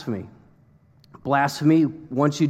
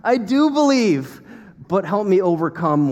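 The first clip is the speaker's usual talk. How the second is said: father crying out; 4 semitones higher than usual. Retold or imitated. Father of a possessed boy begging Jesus, crying out. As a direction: plead it out as a desperate father. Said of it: "instantly cries out".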